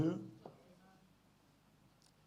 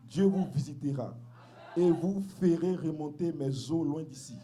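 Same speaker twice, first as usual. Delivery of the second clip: scripture reading; 3 semitones lower, slower than usual. Acted. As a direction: read the verses slowly and reverently. Reads scripture aloud.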